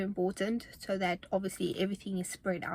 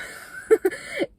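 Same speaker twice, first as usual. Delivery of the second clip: breathless laugh; 5 dB louder than usual. Real, not acted. Laughs about lack of cardio.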